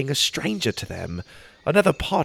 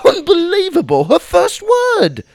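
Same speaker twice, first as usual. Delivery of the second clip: cheering; 9 dB louder than usual. A narrator's reading. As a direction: cheer her first word with joy. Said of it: "I cheered".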